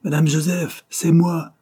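high, loud call to the concierge. A narrator's reading. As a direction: shout across the dark porch. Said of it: "cria Claude à la concierge".

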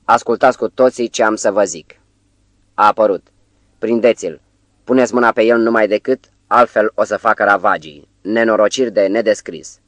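ordinary delivery, nothing marked

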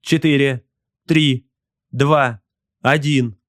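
crisp high-voiced launch count; firing countdown. A narrator's reading. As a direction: count down to launch, each number set apart. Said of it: "Он начал отсчёт".

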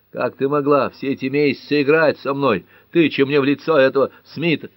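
high, loud accusing outburst; heated reproach. A narrator's reading. Jealous man accuses woman of playing with him, reproachful.

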